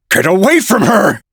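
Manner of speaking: high angry shout. A narrator's reading. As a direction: yell to drive him off. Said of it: "I shouted".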